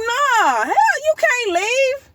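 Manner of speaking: high-pitched begging, not letting him go; pleading protest. Retold or imitated. Begging the angel not to leave, in a high desperate plea. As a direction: plead with a friend who is leaving.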